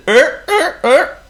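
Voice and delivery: high, loud honking er-er; seal honk. Acted.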